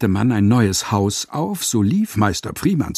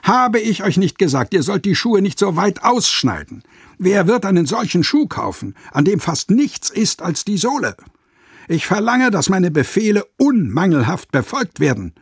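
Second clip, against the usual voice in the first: higher, raised, angry tirade; scolding shout; 7 semitones higher than usual. A narrator's reading. Master berating his apprentice, angry tirade. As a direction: berate the apprentice over the shoe. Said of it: "schrie er ihn an".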